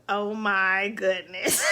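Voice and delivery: slow, laughing-crying cry of joy; real overwhelmed joy. Real, not acted. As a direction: let it burst out, half laugh, half sob. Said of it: "You know I'm super emotional".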